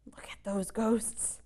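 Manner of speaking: soft hushed whisper. Real, not acted. Reader whispers to the listening child about the ghosts. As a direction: breathe it so the ghosts won't hear.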